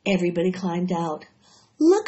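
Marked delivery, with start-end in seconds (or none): 1.8-2.1 excited cry. A narrator's reading. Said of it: "cried Fern".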